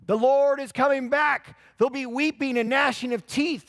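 loud, high preaching shout; street preacher yelling. Retold or imitated. Pastor on the corner yelling at passers-by, loud. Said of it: "he was just yelling".

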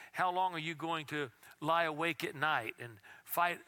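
ordinary talk

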